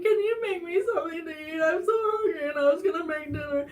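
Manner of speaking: high-pitched mock crying plea; high, pitiful blubbering; tearful pleading. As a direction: re-enact whimpering for food through tears. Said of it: "I just kept, like, coming out".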